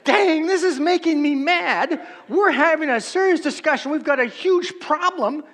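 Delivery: mock-angry outburst, high and loud; exasperated Cleopas. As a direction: play Cleopas blowing his top at the stranger. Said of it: "You can just hear Cleopas".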